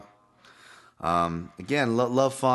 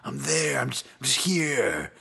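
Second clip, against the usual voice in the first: clueless fighter voice; 4 semitones higher than usual. Retold or imitated. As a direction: act the lost fighter at his introduction. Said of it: "I just see a guy going".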